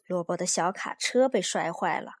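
ordinary delivery

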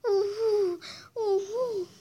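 high wailing boo-hoo sobs; child sobbing. Acted. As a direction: cry out like a sulking child. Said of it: "他难过的大声哭起来".

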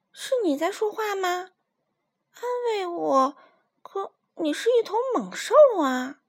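put-on high, amazed little-girl voice; surprised. Minus amazed the lion talks to her, high surprised voice. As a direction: gasp it out in disbelief. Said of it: "很惊讶".

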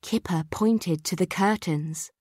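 plain reading voice

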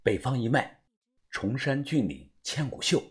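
low, quiet recital; murmured low, under the voice; hushed code phrase. A narrator's reading. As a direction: say it softly like a secret password. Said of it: "小声地说道".

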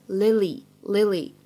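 "lily" with two different vowels; correct Lily pronunciation. Shown as a demonstration. Teacher models the name for students, "lily" with two distinct vowels. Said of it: "应该正确的读法呢是".

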